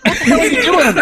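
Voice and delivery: high, fast, comic yelp of disbelief; hammed-up exasperation; mock exasperated outburst. Retold outburst at noisy dad.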